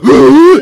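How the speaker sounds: sharp loud gasp, high; loud gasping laugh. Retold or imitated. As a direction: gasp in hard like a wheeze laugh. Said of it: "he has this".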